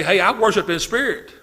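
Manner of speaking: ordinary talk, nothing marked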